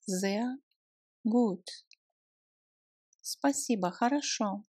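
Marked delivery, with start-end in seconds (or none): none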